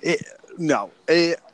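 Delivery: bouncing while talking; on a trampoline. Real, not acted. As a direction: voice jolted by bouncing. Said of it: "oh, I'm on the trampoline".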